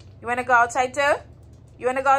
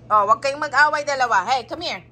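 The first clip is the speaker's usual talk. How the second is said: usual speaking voice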